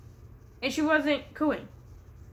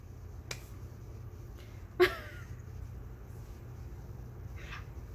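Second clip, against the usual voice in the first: wheezy laugh; 11 dB quieter than usual. Real, not acted. Wheezing laugh at own joke.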